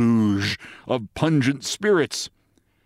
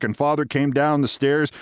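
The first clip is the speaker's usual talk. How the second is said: plain reading voice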